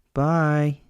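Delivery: ordinary delivery, nothing marked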